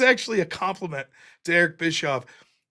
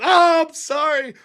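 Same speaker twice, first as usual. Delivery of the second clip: mock sympathy; 7 semitones higher than usual.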